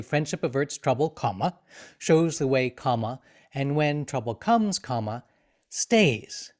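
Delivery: phrase, then a dropped "comma", ending on a lifted "stays"; punctuation read aloud. Shown as a demonstration. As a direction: dictate the sentence, saying "comma" at every break. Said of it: "we would include commas. We would say".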